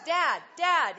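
put-on high, loud child's call; eager little boy calling for dad. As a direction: tug at dad's sleeve with your voice.

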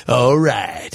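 low, smooth DJ impression; cool DJ voice. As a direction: drop low like a club DJ. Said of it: "His was like".

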